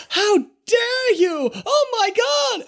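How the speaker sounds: very high, slow, shouted outrage; hammed-up scandalized cry; outraged shriek. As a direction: shriek in shock at the attack.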